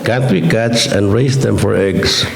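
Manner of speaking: ordinary talk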